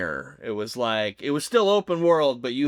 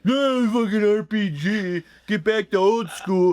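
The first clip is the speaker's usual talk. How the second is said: gruff, slow, higher gamer-troll sneer; 7 semitones higher than usual. Retold or imitated. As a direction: sneer it like an angry internet gamer. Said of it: "I'm done doing my troll voice".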